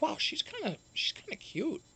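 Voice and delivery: high, soft, taken-aback admiration; pleasantly surprised. Retold or imitated. Remembering watching the interview, high soft surprise. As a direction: gush a little, caught off guard. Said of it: "I'm like".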